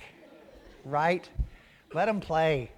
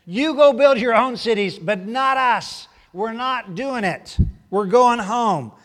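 louder and higher, slowing down with defiance; defiant refusal; 6 semitones higher, 8 dB louder, slower than usual. Retold or imitated. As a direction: dig in and refuse, slow and firm. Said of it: "official decree that says".

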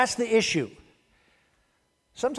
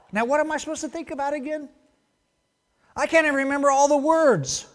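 higher, fretful overwhelmed believer; frazzled; 8 semitones higher, 5 dB louder than usual. Retold or imitated. Preacher voicing a forgetful believer, higher and flustered. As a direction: sputter like you forgot the list.

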